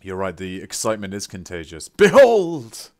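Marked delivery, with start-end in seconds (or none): 1.9-2.9 booming high proclamation, drawn out; theatrical announcer. Acted. Streamer hails a new subscriber like a herald, booming. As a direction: announce each gift like a herald.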